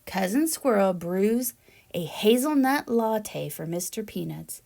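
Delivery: ordinary delivery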